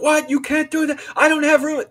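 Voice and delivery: high, loud imitation of an outraged mother; mock yelling. Mom yelling at grown child about moving a computer in, mock shriek. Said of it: "she was yelling".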